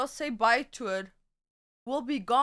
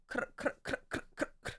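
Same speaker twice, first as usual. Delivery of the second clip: whispered clicking noise; 9 dB quieter, much faster than usual. Pretend. Imitated crackle sound.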